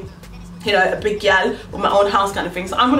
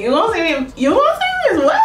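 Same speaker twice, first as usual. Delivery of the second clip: hammed-up gushing question, soaring at the end; giddy disbelief; 10 semitones higher than usual. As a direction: voice climbing to a high squeal. Gushing inner reaction to his nickname.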